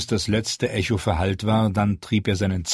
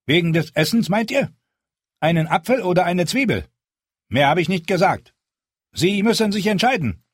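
confused Hal; 10 semitones higher, 4 dB louder than usual. Acted. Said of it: "Hal sah ihn verwirrt an".

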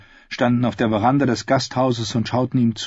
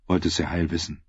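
ordinary delivery

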